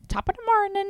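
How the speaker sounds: high-pitched stage-Irish catchphrase; hacky Irish accent. Acted. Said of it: "misrepresented in the hacky".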